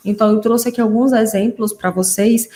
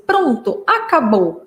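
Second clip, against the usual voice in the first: imitated child echolalia; 3 semitones higher, much slower than usual. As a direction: mimic a little girl announcing the game is over. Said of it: "ou então ela falava assim". (imitando a fala ecolálica da criança)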